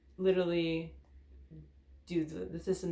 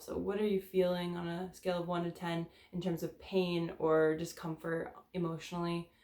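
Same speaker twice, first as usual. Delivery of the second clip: usual speaking voice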